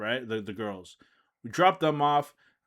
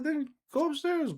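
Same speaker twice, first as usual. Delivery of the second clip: excited. Retold or imitated. Urging girl, very high.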